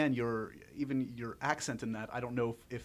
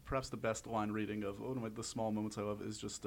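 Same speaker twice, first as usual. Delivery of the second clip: ordinary talk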